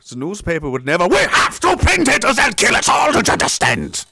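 high, loud, desperate pleading; frantic newspaper boss. Pretend. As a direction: beg them like a panicked editor.

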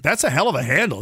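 normal talking voice

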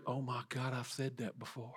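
soft, dismayed self-talk; hushed realization. Acted. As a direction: drop to a near whisper, as if caught out. Voicing a listener's dawning realization.

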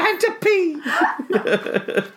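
put-on high, slow, whiny baby. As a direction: whimper it like a toddler. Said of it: "You're a little baby".

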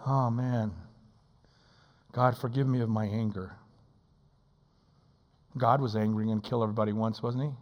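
ordinary delivery

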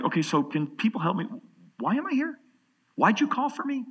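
high bewildered questioning; mock-confused voice rising very high; puzzled Peter. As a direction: play Peter baffled at being called.

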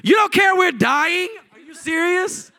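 shouted, very high-pitched disbelief; acted indignant shout at the teacher; furious disciples. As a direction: yell it in outraged incredulity. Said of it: "They are furious with the Lord".

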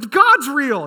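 loud, high burst of realisation; mock exclamation. Everyone after the fire from heaven, loud high mock exclamation. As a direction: blurt it out like the obvious just hit. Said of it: "everyone's like".

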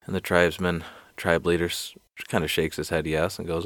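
normal talking voice

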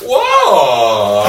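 long drawn-out British whoa, mimicked; mock British exclamation; British impression. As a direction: imitate a British man's lingering whoa. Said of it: "he's very British".

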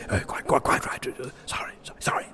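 hushed apology in church; whispering. Real, not acted. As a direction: whisper sorry quickly.

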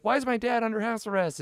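a hint of a small child asking innocently; imitating the daughter. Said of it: "She's like".

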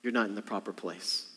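quiet, calming rebuke; soft hush. Retold or imitated. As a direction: hush the storm gently.

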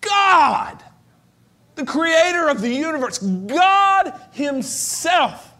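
high loud proclamation, God himself drawn out slowly; proclaiming. Real, not acted. Preacher making God himself carry weight, loud and slow. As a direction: shout it to the rafters. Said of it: "don't want to gloss over these words".

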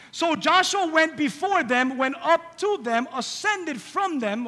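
slow, high proclaiming; preaching. Acted. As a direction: preach it slowly.

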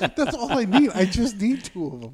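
high loud eager; excited. Real, not acted. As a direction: blurt it out eagerly. Excited bargaining.

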